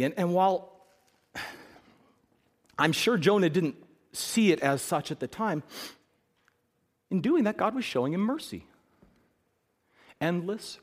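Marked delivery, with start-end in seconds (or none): none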